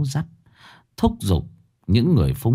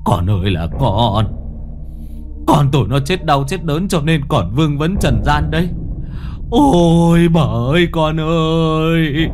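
father breaking into choked lament over his son's coffin; choked wailing; 6 semitones higher than usual. Acted. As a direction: burst into choked sobs for a dead son. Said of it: "òa lên nghẹn ngào".

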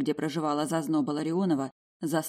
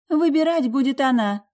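higher, louder smug retort; smirking; 9 semitones higher, 6 dB louder than usual. A narrator's reading. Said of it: "ухмыльнулась Карина".

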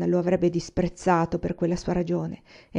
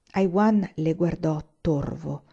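usual speaking voice

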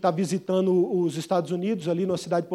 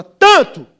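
sudden bid shouted from the back; 10 semitones higher, 15 dB louder than usual. Acted. Englishman yelling his bid across the auction. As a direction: yell the bid out at full force. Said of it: "ele dá um grito lá de trás".

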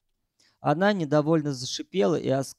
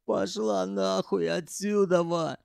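еле сдерживая крик; 4 semitones higher, slower than usual. A narrator's reading. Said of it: "еле сдерживая крик".

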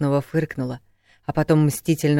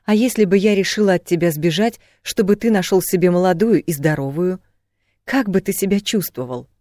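raised, heated questioning; challenging question; 5 semitones higher, 5 dB louder than usual. A narrator's reading. Woman turning the question on him, challenging. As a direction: confront him with it.

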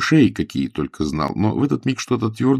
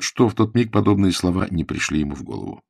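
plain reading voice

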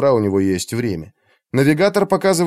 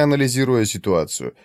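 normal talking voice

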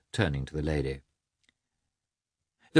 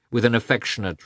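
ordinary delivery, nothing marked

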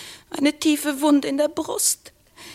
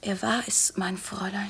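grieving whisper; 5 semitones lower, 8 dB quieter than usual. Acted. Girl speaks of dead father.

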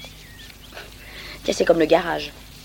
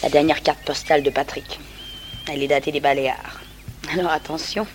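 normal talking voice

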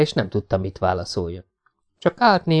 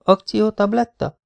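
higher, slow puzzled repeat of "Akciótabletta"; puzzled echo; 10 semitones higher than usual. A narrator's reading. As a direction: echo "Akciótabletta" in disbelief, drawn out. (értetlen visszakérdezés: „Akciótabletta?”)

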